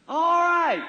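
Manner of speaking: chuckling through the words; laughing. Real, not acted. Preacher laughing at his own horse joke. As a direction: laugh it off and move on.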